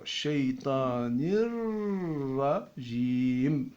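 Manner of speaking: "Minash shaytanir rajim" recited slowly with linked words; slow recitation. Shown as a demonstration. Teacher showing the reading, "Minash shaytanir rajim" slow and linked. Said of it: "suivez-moi".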